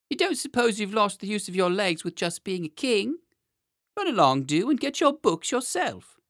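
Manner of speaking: high, loud, fussing nursemaid; scolding nurse. A narrator's reading. Nurse sending the boy king for his own books, brisk high scolding. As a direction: chide the child in a high, loud voice.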